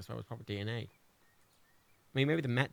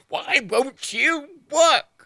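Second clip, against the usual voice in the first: pleading with a machine; 10 semitones higher, 8 dB louder, much slower than usual. Real, not acted. Frustrated at the crashing game file.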